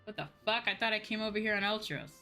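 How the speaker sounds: ordinary talk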